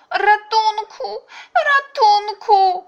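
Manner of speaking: high, drawn-out dolphin shout; cry for help. A narrator's reading. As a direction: scream for help, very high and slow. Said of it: "zawołał delfin". (wysokie, przeciągłe wołanie o pomoc)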